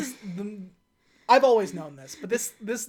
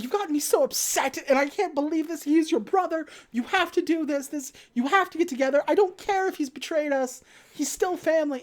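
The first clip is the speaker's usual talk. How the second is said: high, tearful guilt-tripping mother; mock crying; 7 semitones higher than usual. Mother guilts son over his brother, mock crying. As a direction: plead in an exaggerated high weepy voice. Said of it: "she starts to cry".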